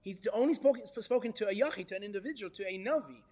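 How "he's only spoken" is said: normal talking voice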